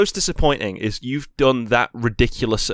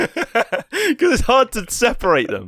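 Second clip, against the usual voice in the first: laughing; 10 semitones higher, slower than usual. Real, not acted. Laughing while explaining.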